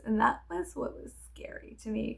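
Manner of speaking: ordinary talk, nothing marked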